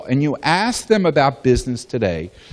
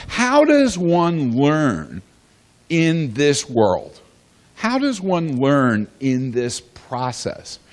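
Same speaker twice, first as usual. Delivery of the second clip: slow emphatic question; much slower than usual. Real, not acted. Rhetorical question, slowed for emphasis.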